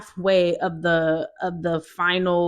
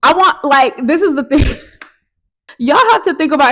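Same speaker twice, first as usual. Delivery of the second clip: loud laughing through the words; real loud laugh while telling it; laughing loudly; 7 semitones higher, 11 dB louder than usual. Real, not acted. Laughing loudly at her own story.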